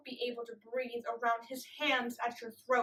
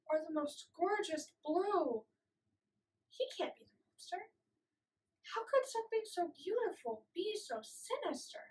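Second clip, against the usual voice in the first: soft, higher-pitched wondering; hushed disbelief; 4 semitones higher, 7 dB quieter than usual. Acted. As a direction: question it quietly, unable to believe it. Disbelief at a beautiful attacker.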